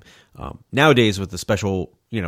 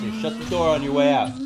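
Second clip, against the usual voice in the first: mocking; 8 semitones higher than usual. Dismissing co-host.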